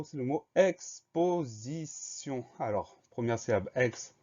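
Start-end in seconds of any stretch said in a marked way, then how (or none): 0.6-2.4 slow, drawn-out syllables, pitched high; syllable-by-syllable dictation. Shown as a demonstration. Teacher introducing the next spelling word, slow syllable-by-syllable dictation.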